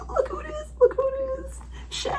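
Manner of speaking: high, soft, choked-up voice; nearly crying with joy; teary. Real, not acted. Reader moved by character's return, high teary voice.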